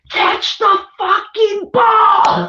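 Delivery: high, drawn-out fan's yell; mock yelling out. Wife acts out the yell her husband feared, high mock holler. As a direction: holler it slowly, like at the game. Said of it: "I was gonna yell out".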